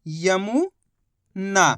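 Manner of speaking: यमुना drawn out slowly, syllable by syllable; slow syllable stretch. Shown as a demonstration. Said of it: "spelling out syllables".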